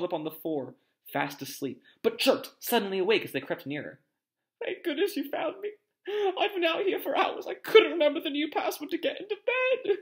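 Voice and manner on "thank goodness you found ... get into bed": very high, tearful squeak; distressed Neville. A narrator's reading. Neville, locked out, greets his rescuers, distressed. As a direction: wail it out high and fretful.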